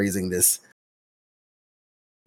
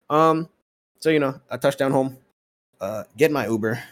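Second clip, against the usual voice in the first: ordinary talk, nothing marked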